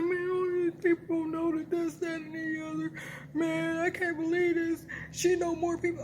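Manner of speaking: high whiny complaining voice; whining voice. Retold or imitated. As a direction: whine it out like a sulky man. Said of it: "he always does it in a whining voice".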